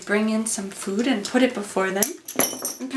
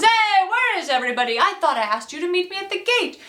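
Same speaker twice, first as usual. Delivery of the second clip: scolding master; 7 semitones higher than usual. Retold or imitated. Petruchio scolds his servants on arrival.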